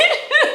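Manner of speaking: quick high-pitched laugh; high squeak of laughter. Real, not acted. Amused by the coworkers changing subject, squealing laugh.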